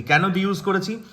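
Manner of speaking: normal talking voice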